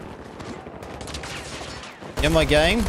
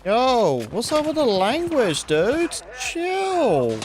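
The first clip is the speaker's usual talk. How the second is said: mock scolding; 9 semitones higher, much slower than usual. Scolding the game's foul-mouthed character.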